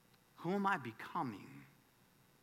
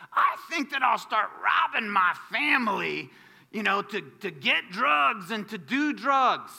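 mock shouted thought; 4 semitones higher, 8 dB louder than usual. Voicing addict's imagined thought.

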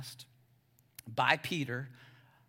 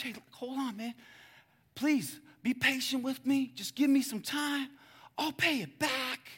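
pleading; 9 semitones higher than usual. Acted. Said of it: "the same speech".

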